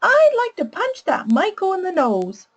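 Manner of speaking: put-on a grumpy kid up in a high voice; high child voice. Shown as a demonstration. Educator playing the angry child in a high voice. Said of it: "I'll use a really high voice to read the child's problem".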